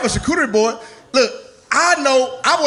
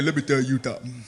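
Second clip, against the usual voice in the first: much lower, softer, mumbled deaf man's voice; Fat Albert impression; 8 semitones lower, 7 dB quieter than usual. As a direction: drop low and quiet, slurring the words. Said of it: "He was talking like this".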